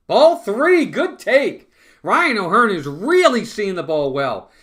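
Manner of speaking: high, loud burst of excitement; excited call. Real, not acted. Fan calling the count to his chat, thrilled and loud. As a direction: cheer the count like it just broke your way.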